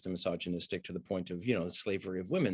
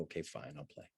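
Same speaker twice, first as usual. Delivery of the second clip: much softer, lower mock surrender; resigned giving in; 7 semitones lower, 16 dB quieter than usual. Actress gives up resisting her co-star, softly resigned. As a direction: let it fade out in defeat. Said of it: "and go".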